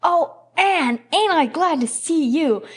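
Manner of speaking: put-on high, loud gleeful shout of a boy; joyful yell. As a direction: burst out with delight as young Davy. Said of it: "with a joyful yell".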